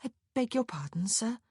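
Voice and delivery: put-on high, sweet young lady's voice; sweetly polite. A narrator's reading. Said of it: "Prudence said sweetly".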